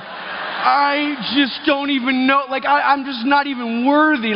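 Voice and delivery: high, slow, reverent awe; mock-humble kneeling wonder; awed boys. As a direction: worship him like a hero, voice lifted. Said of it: "we were just like".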